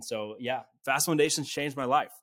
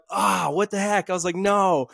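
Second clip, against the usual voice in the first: higher-pitched, drawn-out groan of disappointment; exasperated retold protest; mock dismay; 6 semitones higher, 4 dB louder than usual. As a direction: act out being let down. Retelling his reaction to rejection.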